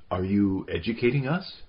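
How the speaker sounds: challenging question with a climbing end; retort, pitch rising to the last word. A narrator's reading. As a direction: throw it back as an affronted question. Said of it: "The politician retorted".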